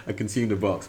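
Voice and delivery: ordinary talk, nothing marked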